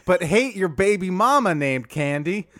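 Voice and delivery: Dwight Howard voice. Acted. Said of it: "can we dial that accent up a little".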